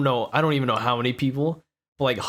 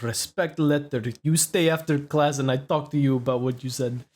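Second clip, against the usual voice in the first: put-on Lebanese accent. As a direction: scold a pupil in a mock Lebanese accent. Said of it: "an accent. He was like".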